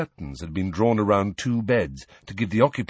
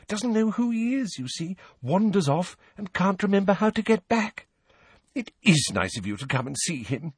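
higher-pitched, kindly fussing healer; chatty healer; 10 semitones higher than usual. A narrator's reading. Healer explaining her patient to his visitors, chatty. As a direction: play a chatty ward healer, voice lifted.

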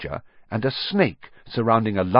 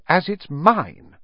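higher, slow and pointed; furious; 10 semitones higher, 4 dB louder, slower than usual. A narrator's reading. Boy claims his own letter, furious. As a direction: stake the claim angrily. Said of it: "said Harry furiously".

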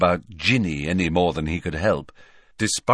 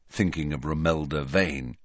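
usual speaking voice

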